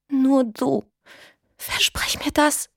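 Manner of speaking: high, slow, hushed plea; dying whisper. A narrator's reading. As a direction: breathe it out weakly. Sterbende Frau flüstert. (schwaches, sterbendes Flüstern)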